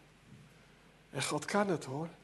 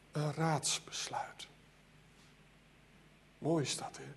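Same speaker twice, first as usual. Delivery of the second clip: whisper; 3 semitones lower, 11 dB quieter than usual. Acted. Hushed close to a phrase.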